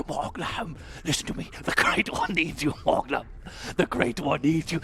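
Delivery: eerie pumpkin voice, urgent summons; creepy pumpkin. Acted. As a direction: plead as a spooky pumpkin. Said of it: "it sounds creepy".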